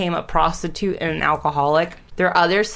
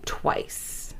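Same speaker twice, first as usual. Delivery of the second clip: whispered; 9 dB quieter than usual. Real, not acted. Grim detail of suicide attempts.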